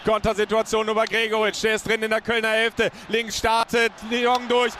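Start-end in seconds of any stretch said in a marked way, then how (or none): none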